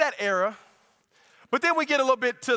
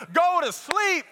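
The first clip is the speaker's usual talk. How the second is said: mock yell; 9 semitones higher, slower than usual. Joking rant at the singer.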